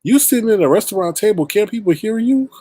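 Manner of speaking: high-pitched mock disbelief; retold incredulous question; incredulous retelling. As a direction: ask it high, as if you can't believe him. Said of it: "I myself was sitting there like".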